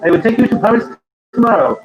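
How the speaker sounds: hammed-up French accent, promising Paris. Promising his wife a trip to Paris, in a mock French accent. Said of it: "Is that your French accent?".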